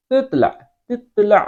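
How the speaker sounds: تطلع ending on a throaty Arabic ع; sounded ain. Shown as a demonstration. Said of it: "عین کی آواز نکالیں گے".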